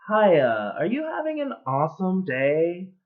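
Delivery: put-on high, perky counter-girl greeting, first word loud; imitated upbeat fast-food greeter, pitched high. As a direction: chirp it like an over-keen cashier. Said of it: "girl behind the counter said".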